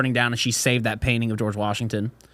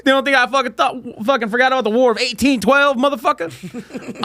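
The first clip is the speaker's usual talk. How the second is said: high, hammed-up indignation; mock outrage; 11 semitones higher than usual. Joker remembering the War of 1812, mock outrage. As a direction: crow it in a pushed-up voice.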